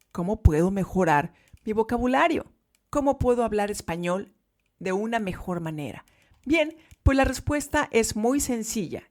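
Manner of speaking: usual speaking voice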